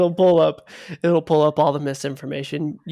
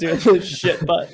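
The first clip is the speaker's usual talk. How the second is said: laughing; 5 dB louder than usual. Real, not acted. Giggling over a silly pun.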